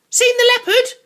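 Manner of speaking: put-on high, boyish voice for the Indian; a keen boy in costume asking his friend; boy playing Indian. Indian boy asks Barney about the leopard, high and eager.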